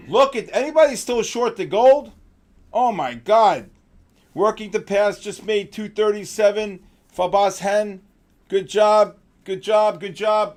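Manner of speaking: real hype praising winning trades; hyped up. Real, not acted. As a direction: cheer the traders on. Hyped calls to traders live.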